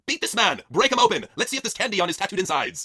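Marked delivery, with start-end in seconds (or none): 0.1-1.2 louder, barked orders; rally the ladies to attack; ordering the ladies to beat the pinata; commanding shout. Acted.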